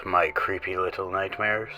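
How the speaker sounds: usual speaking voice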